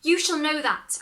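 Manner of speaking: high, loud sure promise; confident promise. Acted. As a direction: declare it with full confidence. Hero promises.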